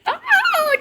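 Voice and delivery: a sudden very high oh; surprised oh. Real, not acted. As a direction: let the oh jump up in surprise. Reacting to a viewer's offer.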